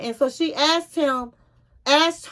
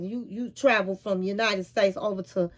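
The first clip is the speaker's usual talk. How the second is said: ordinary talk, nothing marked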